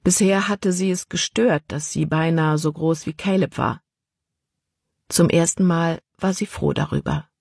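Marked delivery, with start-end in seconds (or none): none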